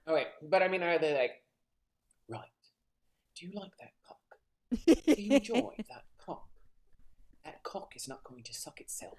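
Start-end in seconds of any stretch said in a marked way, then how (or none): 2.3-6.5 a lightly put-on posh English voice, purring filth; hint of posh English bedroom talk; mock posh English dirty talk. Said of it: "posh English accents sound like when they're talking dirty". 7.4-9.1 breathy whisper in a put-on posh English voice; whispered posh English dirty talk. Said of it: "posh English accents sound like when they're talking dirty".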